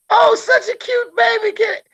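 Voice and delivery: very high, gushing coo; mock baby-admirer falsetto; imitating cooing stranger. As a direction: squeal over a cute baby in a put-on high voice.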